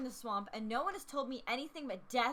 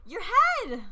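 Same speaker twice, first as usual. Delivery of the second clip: high, loud cry of alarm; shocked shout; 9 semitones higher, 12 dB louder than usual. Real, not acted. Player shouting at the man with burning hair, loud. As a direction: yell it out in alarm.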